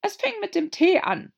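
timid little hatter. A narrator's reading. Hatter voiced.

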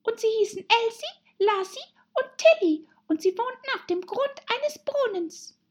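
very high, squeaky little voice; tiny high-pitched mouse storyteller; hurried dormouse. A narrator's reading. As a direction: squeak the tale out. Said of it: "begann die Haselmaus in großer Eile".

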